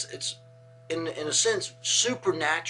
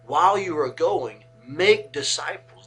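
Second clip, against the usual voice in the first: louder, weighted quoted command; emphatic quote; 2 semitones higher, 8 dB louder than usual. Retold or imitated. Quoting Jesus' command, literal rendering.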